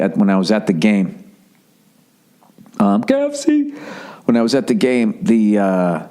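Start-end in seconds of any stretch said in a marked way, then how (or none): 3.0-3.7 driver voice callback. Retold or imitated.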